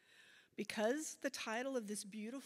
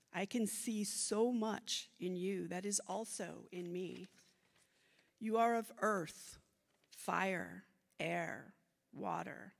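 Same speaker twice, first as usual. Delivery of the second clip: measured, louder poem recital; poetry reading; 5 dB louder than usual. Acted. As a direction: read it like a poem onstage. Reads her bilingual poem aloud.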